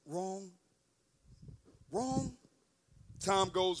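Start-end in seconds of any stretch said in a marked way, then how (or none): 0.0-0.5 very soft and low; patient, kind voice. Retold or imitated. Inner voice correcting a young man, soft and patient. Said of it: "It was a kind voice". 1.9-2.4 soft, unhurried correction; kind voice. Retold or imitated. Inner voice correcting a young man, soft and kind. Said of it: "It was a kind voice".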